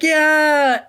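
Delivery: high, loud mock cheer from a crowd. Crew cheering their new captain, excited shout. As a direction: whoop it up like the whole crew agrees. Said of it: "everybody's like".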